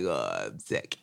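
low, groaning mock sick moan; mopey sick groan; whiny sick husband. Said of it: "you lay in bed and you just like".

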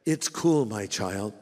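mock voice of God. Preacher mocking believers with a lax God voice. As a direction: play God as a too-easygoing father figure. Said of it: "The Lord said".

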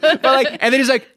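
usual speaking voice

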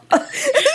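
real burst of high laughter. Real, not acted. Laughing at her own lizard joke, high laugh. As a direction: let the laugh break high.

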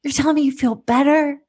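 mother snapping back at her friend in the car, high and disbelieving; incredulous retort. Retold or imitated. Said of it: "I just turned to her and I said".

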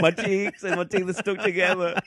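high, giddy mock hyperventilation; worked up and high-pitched, half laughing; reliving the panic. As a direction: relive the starstruck panic, voice climbing. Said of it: "I'm back in the moment".

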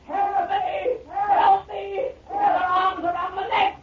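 being pulled under, screaming; drowning screams. Acted. Man dragged under water.

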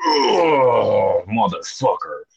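wincing groan through the pain. Retold or imitated. Rower reliving hand pain, pained groan. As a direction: groan like salt water hit an open cut. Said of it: "I'd just be like".

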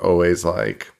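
normal talking voice

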